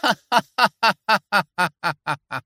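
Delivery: short scornful villain laugh; sarcastic laugh. A narrator's reading. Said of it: "أطلق إيلي ضحكة ساخرة".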